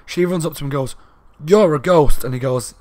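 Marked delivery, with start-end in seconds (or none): 1.5-2.2 accusing shout. Retold or imitated. Carly confronts Clark accusingly.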